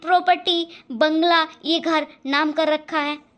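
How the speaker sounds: loud, furious accusation at the husband; angry shout. Acted. Stepmother yelling at husband over property, furious. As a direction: spit out the insult at full volume.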